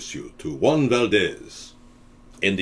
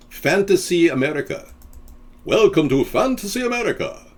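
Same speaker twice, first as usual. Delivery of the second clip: higher, grand host voice calling out; Ricardo Montalban; 7 semitones higher than usual. Retold or imitated. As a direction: play the suave host pointing at the sky. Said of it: "Ricardo Montalban".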